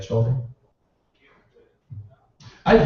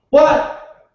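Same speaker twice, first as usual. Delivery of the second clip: annoyed shout back; 12 semitones higher, 5 dB louder than usual. Retold or imitated. Answering the child.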